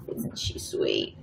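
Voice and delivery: soft whispered aside. Real, not acted. Painter whispering to viewers about her dog, hushed. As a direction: breathe it to the viewers.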